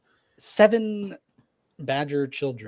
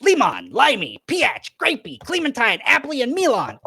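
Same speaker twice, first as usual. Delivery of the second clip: higher, louder proud roll call; listing the kids; 12 semitones higher, 9 dB louder than usual. Acted. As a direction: rattle off each name proudly. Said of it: "he goes".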